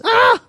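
sharp high mock shriek; a short high demon shriek; spirit's shriek. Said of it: "the mute spirit shrieks".

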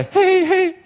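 very high squeaky mock whinny; a silly failed horse noise; bad horse whinny. Shown as a demonstration. Preacher fumbles a horse sound, laughing at himself. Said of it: "Is that a horse?".

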